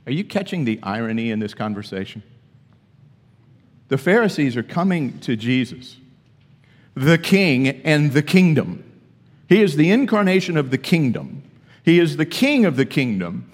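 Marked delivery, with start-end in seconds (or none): 3.9-13.4 preacher thundering at the congregation; emphatic preaching. Acted. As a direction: pound each word about the king. Emphatic preaching about the king.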